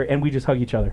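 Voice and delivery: ordinary talk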